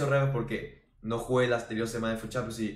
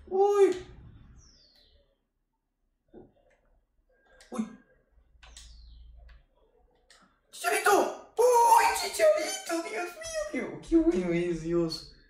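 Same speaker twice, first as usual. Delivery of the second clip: gritos agudos y fuertes; emoción de jugada; 5 dB louder than usual. Real, not acted. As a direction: exclama asombrado por la jugada. Celebra jugada en partida. (grito de asombro eufórico)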